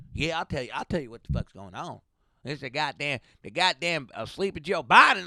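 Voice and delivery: mildly played Uber driver rant; Texan driver. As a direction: lightly voice the political driver. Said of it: "they wanna let you know".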